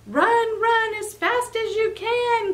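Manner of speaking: high, sing-song cookie taunt. Acted. Cookie taunting Fox to chase him, high sing-song voice. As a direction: tease the fox with a high chant.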